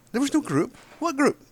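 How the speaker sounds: high-pitched, incredulous denial; exasperated disbelief. Real, not acted. Man denying there ever was a group, exasperated. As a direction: protest with the pitch shooting up. Denies the quoted claim, exasperated.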